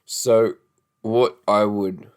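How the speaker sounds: normal talking voice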